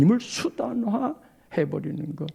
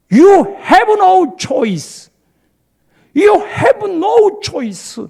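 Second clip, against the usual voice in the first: very slow, higher and louder, each word stretched; drawn-out emphasis; 10 semitones higher, 10 dB louder, much slower than usual. Shown as a demonstration. Preacher spells out the mother's real meaning, very slowly. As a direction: lay it down word by word.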